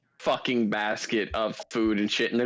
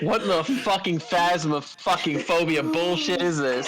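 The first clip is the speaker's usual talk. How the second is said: panicking gamer; 5 semitones higher than usual. Real, not acted. Reacting to jump scare.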